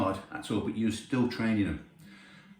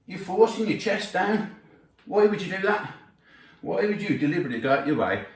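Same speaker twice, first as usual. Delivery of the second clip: incredulous challenge; 6 semitones higher than usual. Real, not acted. Rhetorical challenge to bad technique.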